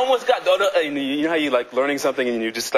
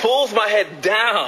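excited; 8 semitones higher, much slower than usual. Retold or imitated. Dramatic retelling of fall.

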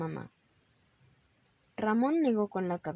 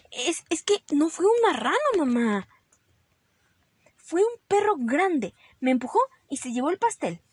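put-on higher, louder child voice insisting on a fib; little rabbit Ramón protesting; 8 semitones higher, 7 dB louder than usual. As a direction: stammer into a fresh excuse.

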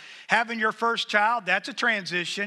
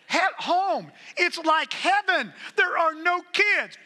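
much higher and louder, slowly savoring it; gleeful delight; 12 semitones higher, 5 dB louder, slower than usual. Real, not acted. Preacher joking to the congregation about an empty nest, with high gleeful delight. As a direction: crow about the empty house like it's heaven.

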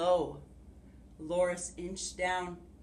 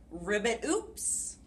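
frog voice; slower than usual. Acted. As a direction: play the clumsy frog. Frog voice in picture book.

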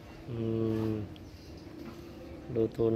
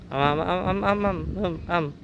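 nom nom; 7 semitones higher than usual. Pretend. Feeding the child, cooing.

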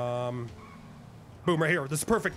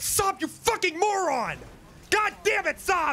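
high-pitched, drawn-out furious outburst; angry scolding; 8 semitones higher, slower than usual. Real, not acted. Boss berating Saab for the botched ambush, angry. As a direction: berate him, voice shooting up.

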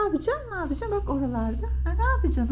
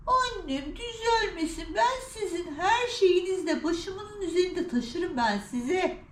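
slow, higher-pitched crooning to pets; doting; 4 semitones higher, slower than usual. Real, not acted. Owner tells her cats they needn't improve, doting. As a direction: stretch the words out in adoring pet talk.

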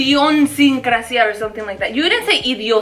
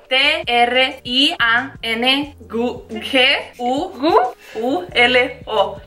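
slow letter by letter; spelling it out; slower than usual. Shown as a demonstration. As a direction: spell each letter out slowly. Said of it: "make sure you're clear about it".

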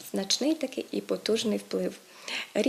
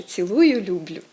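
цілую, люблю with люблю stressed on ЛЮБ-; wrong root stress; 2 semitones lower, 6 dB louder than usual. Retold or imitated. Teacher quotes TV host's sign-off, люблю stressed on first syllable. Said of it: "ведучий в кінці каже".

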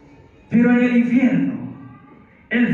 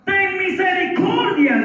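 higher-pitched preacher's plea; pleading cry; 6 semitones higher than usual. Acted. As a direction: cry out for mercy as the rich man. El rico clama a Abraham. (súplica clamada del rico)

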